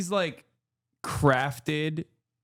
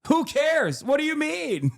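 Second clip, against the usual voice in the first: much higher, dismissive disbelief; incredulous retort; 10 semitones higher than usual. Retold or imitated. Retelling his reply to a streamer, high incredulous scoff. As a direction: scoff at a stupid argument.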